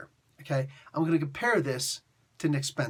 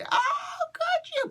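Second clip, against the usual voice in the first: shrill, much higher mock shriek of outrage; mock outraged geeks; much slower than usual. Reviewer mocking fans freaking out over Nazi Cap, hammed-up shriek. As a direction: ham up the fans' horrified scream. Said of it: "geeks everywhere went crazy".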